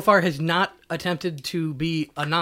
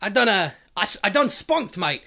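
put-on pitched-up jokey cockney accent; light cockney impression, higher; mock cockney; 4 semitones higher than usual. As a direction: play a cockney lad bragging. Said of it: "that's what they say".

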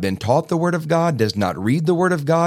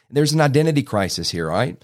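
ordinary delivery

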